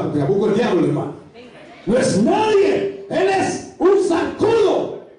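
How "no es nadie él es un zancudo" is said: slow, high, drawn-out divine dismissal; voice of God. Acted. Preacher voicing God belittling the devil, slow and lofty. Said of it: "dice Dios".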